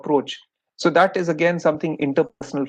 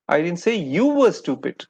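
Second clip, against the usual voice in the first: emphasis shifted onto "you"; stress on YOU. Shown as a demonstration. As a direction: hit the word "you", let "stupid" fall. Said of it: "the emphasis has shifted to you".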